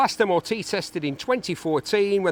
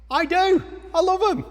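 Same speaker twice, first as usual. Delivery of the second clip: gushing; 12 semitones higher, 4 dB louder than usual. Real, not acted. Confessing love of campers.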